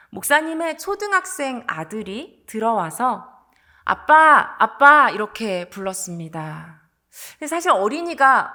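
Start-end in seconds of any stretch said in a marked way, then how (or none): 3.8-5.1 loud, higher call for Dad; imitated child's shout. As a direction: call out to your father like a young son. Young son calls his father.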